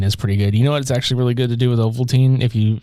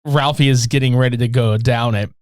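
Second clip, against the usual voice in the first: ordinary delivery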